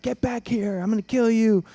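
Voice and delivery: held-back scream of a pursuer; mock screaming. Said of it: "And the guy's screaming".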